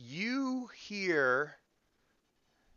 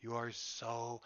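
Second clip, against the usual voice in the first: slow flattering; 2 semitones lower, 7 dB quieter, much slower than usual. Acted. Little sister flattering brother.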